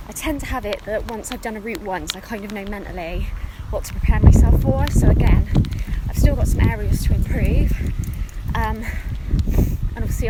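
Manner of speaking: breathless, talking mid-jog; puffing out words between strides; while running. Real, not acted. Talking mid-run, outdoors, loud.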